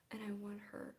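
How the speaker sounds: breathy whispered want; whispering. Real, not acted. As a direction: whisper it like a secret wish. Whispered longing.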